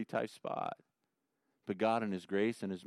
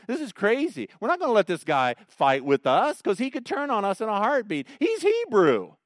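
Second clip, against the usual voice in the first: alarmed Philistine princes; 9 semitones higher, 7 dB louder than usual. Retold or imitated. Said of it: "So what are they saying?".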